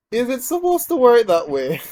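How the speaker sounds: high, loud disbelief breaking into laughter; amazed question. Real, not acted. Gamer questions an unexpected mechanic, amazed. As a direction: ask it loud and high, truly astonished. Surprised a skill works.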